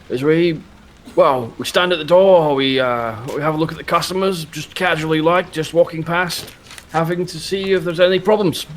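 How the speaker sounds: put-on high, stiff old soldier; a proud veteran sizing up a recruit; old army man voice. Mr Muntz voice.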